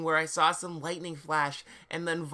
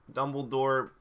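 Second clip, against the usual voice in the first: ordinary delivery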